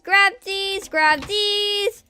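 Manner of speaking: hyped up. Real, not acted. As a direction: chant it while grabbing loot.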